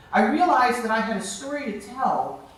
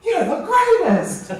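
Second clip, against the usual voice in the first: high, laughing imagined cheer; hammed-up applause line; mock praise with a laugh; 9 semitones higher, 6 dB louder, slower than usual. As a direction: play the crowd you hoped for, laughing. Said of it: "people are like".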